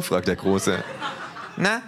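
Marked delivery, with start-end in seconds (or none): 1.6-1.8 high, cheeky kangaroo voice. Acted. Kangaroo teases the neo-Nazis, high and cheeky. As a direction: needle them with a grin.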